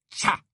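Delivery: short, high "tcha"; sharp, wordless huff of disapproval; disdainful scoff. A narrator's reading. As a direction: spit out a disapproving noise. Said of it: "émit un son qui ressemblait à".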